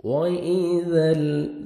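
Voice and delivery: teacher reciting verse melodically; Quran recitation. Acted. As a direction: lift the verse into a slow sung recitation.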